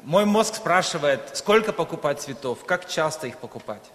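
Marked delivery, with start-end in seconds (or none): none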